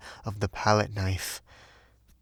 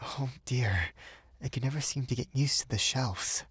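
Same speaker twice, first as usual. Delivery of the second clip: softer, sighing lament; 3 semitones higher, 6 dB quieter than usual. A narrator's reading. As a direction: sigh it out wearily. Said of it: "she only sighed".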